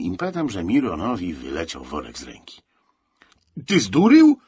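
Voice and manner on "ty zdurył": high, loud shocked outburst; aghast cry, voice leaping up; horrified. A narrator's reading. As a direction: blurt it out in horror. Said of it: "wykrztusił Miron w przerażeniu".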